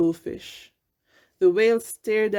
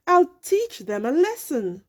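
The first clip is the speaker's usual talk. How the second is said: angry whale; 7 semitones higher than usual. A narrator's reading. The hurt whale vowing revenge, angry. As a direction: vow revenge. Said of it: "he grew very angry".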